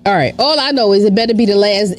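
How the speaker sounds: usual speaking voice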